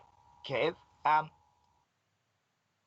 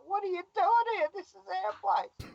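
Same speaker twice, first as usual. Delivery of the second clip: high, put-on voice demanding to know why he's here; mock challenge from the locals. Locals telling the newcomer this is their place, in a high mock voice. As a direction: play the territorial locals squaring up to the newcomer.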